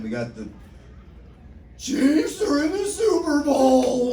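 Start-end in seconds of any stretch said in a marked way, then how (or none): 1.8-4.1 high, slow hammed-up shout; drawn-out high cheer; mock cheer. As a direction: belt it out like a sports fan. Cheering topical news.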